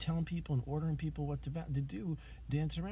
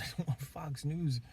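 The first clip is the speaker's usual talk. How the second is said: laughing jab. Real, not acted. Laughing jab at Fox News.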